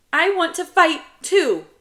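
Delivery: put-on very high, loud kid voice; insistent child. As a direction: plead hard like a little brother.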